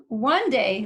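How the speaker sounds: ordinary delivery, nothing marked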